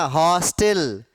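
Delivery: हॉस्टल slowly as "हॉ-स्टेल", open ऑ; open ऑ vowel. Shown as a demonstration. Teacher models हॉस्टल for students, slow open ऑ. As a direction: stretch हॉस्टल with ऑ between आ and ओ. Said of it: "ऐसे ही बोलना".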